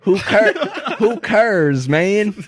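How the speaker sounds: high, loud, hammed-up southern holler; exaggerated southern twang, laughing through it; [name], southern accent. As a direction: whoop it up in a mock southern accent. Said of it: "Why do I have a southern accent".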